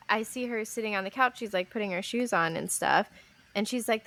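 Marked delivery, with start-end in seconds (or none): none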